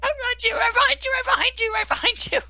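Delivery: very high squeaky repeated warning. Pretend. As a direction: squeal it in playful panic. Teammate warns during chase.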